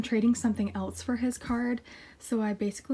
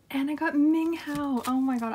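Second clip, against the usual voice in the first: delighted reveal; 5 semitones higher, 4 dB louder than usual. Real, not acted. Collector revealing a well-loved member's card, delighted.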